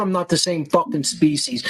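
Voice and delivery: ordinary talk, nothing marked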